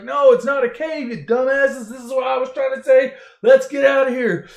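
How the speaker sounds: high, loud mock-angry rant; exasperated Han Solo. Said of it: "And Han's like".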